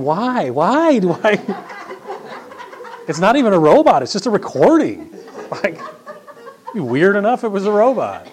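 amused at own quirk; laughing. Real, not acted. Pastor to congregation about thanking a machine, laughing.

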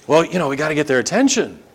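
rattled off as an offhand explanation; quick casual excuse. Acted. Idol worshipper explaining to the preacher, quick and casual. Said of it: "say that again slowly".

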